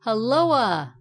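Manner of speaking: loud "Helloa!" with an extra "uh" on the tail; called-out hail. A narrator's reading. Lefty hailing the poker in the clouds, calling out. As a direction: call "Helloa!" loudly, swooping down onto a final "a". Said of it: "called the left hand iron".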